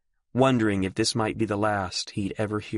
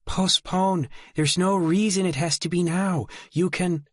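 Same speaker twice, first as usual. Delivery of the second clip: pleading; 10 semitones higher than usual. A narrator's reading. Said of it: "Mary's voice was pleading".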